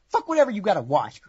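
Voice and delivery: normal talking voice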